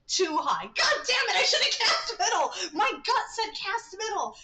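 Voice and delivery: high, loud frustrated shout; frustrated outburst. Real, not acted. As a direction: yell at your own mistake. Game move fails, she erupts.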